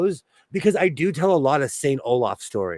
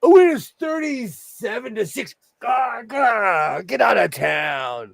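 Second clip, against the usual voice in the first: excited; 7 semitones higher than usual. Pretend. Quoting the show.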